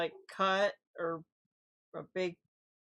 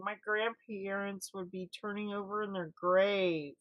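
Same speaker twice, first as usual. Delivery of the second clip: normal talking voice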